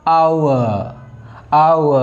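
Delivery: आउ as a slow aa-oo glide; careful au sound. Shown as a demonstration. Teacher drilling the triphthong sound, careful. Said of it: "इस तरह से बोलेंगे".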